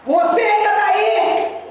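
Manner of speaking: high, slow commanding shout; shouted challenge. Retold or imitated. Pastor confronting the demon at the door, shouted challenge. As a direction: call it out high, stretching the words.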